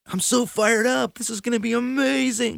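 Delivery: high, hyped-up voice; pumped and buzzing; fired up. Retold or imitated. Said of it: "it was so, like".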